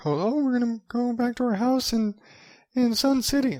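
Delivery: hammed-up old snowbird whine; mock old neighbor. Mocking whiny old snowbird neighbors.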